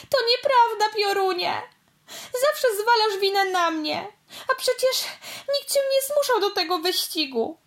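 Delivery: a little car crying and protesting; sobbing. Acted. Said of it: "łkała". (szlochając, wysoko i głośno)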